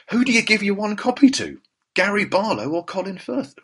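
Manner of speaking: mock dilemma.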